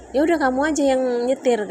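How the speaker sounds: imitating the husband throwing in the towel; fed-up mock retort; husband giving up.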